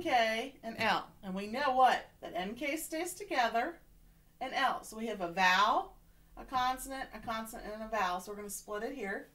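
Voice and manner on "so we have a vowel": ordinary delivery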